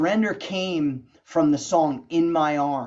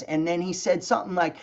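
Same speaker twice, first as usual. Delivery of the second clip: usual speaking voice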